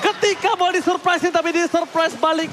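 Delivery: loud, stretched hype shout; fighting game announcer. Acted. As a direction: roar the comeback like an arena caster. Caster shouts the counter.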